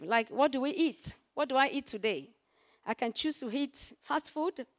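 normal talking voice

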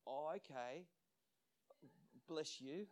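soft, halting imitation of polite humouring; quietly patronise, with pauses; condescending. Sick woman doubting promise of healing, mildly condescending. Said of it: "she was being condescending".

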